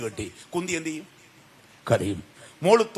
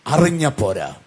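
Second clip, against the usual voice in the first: ordinary talk, nothing marked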